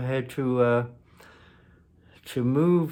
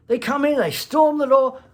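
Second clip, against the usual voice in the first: dramatic outburst; 8 dB louder than usual. Real, not acted. Dramatic peak of the bust story.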